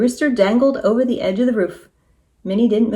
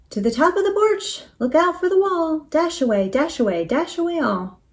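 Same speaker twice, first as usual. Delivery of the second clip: calling out; 8 semitones higher than usual. A narrator's reading. Minnie calling out to the team.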